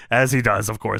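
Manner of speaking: ordinary talk, nothing marked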